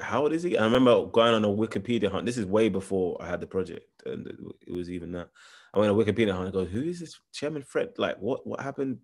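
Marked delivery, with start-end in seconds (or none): 0.0-0.6 high, rising disbelief; retold astonishment, pitch climbing right up; incredulous question. Repeats his astonished reaction. 6.6-9.0 high-pitched retold bewilderment; searching questions pitched up in surprise; baffled questioning. Retells his baffled online search.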